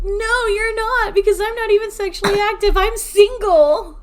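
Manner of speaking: high, loud denial; exasperated high outburst, last word stretched; indignant protest. Real, not acted. Protesting sister's pregnancy tease.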